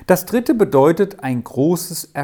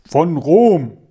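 sudden louder answer from the background; hint of bass voice; 5 dB louder than usual. A narrator's reading. Unseen uncle answers the riddle from the dark, mock bass. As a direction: lightly act a booming interjection. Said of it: "eine tiefe Bassstimme aus dem dunklen Hintergrunde".

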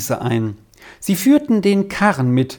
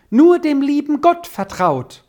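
birds calling together; 10 semitones higher, 3 dB louder than usual. A narrator's reading. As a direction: call it high like birds joining in. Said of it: "Und dann fingen alle zusammen". (hoher, singender Vogelruf)

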